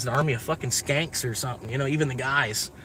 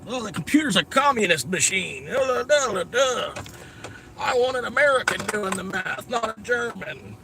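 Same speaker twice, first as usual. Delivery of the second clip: hammed-up, high-pitched blustering American; mock paranoid American; 8 semitones higher than usual. Imagining old NASA folks rejecting computers, hammed-up mock indignation. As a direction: put on a high, exaggerated voice of outraged distrust.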